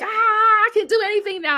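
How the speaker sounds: high, loud excited outburst. Retold or imitated. Retelling the fire-eating rush, excited high cry. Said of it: "I was excited".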